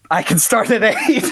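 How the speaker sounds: a light laugh through eager agreement; half-laughing retold yes; laughing eagerly. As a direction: say it while laughing at yourself. Eager reply, laugh heard.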